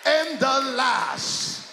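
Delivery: loud, rising sermon cadence, calling out to the church; whooping gospel preacher building to a peak; full-force preaching. Acted. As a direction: preach it up with the music behind you.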